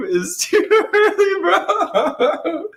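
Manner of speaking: high laughing, drawn out. Real, not acted. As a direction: crack up while saying it. Same line, now cracking up.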